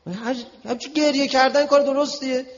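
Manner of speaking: very high, loud, impassioned appeal; strained-high oratory at full force; heated preaching. Real, not acted. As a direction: cry out the argument to the crowd.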